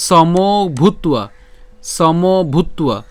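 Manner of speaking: "সমভূত্বা" very slow, drawn on "মো"; careful pronunciation. Shown as a demonstration. Teacher drills the next Sanskrit word, very slow "সমভূত্বা". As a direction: stretch "সমভূত্বা" out, ending in tua. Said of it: "তো উচ্চারণটা কেমন?".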